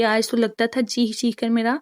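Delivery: ordinary delivery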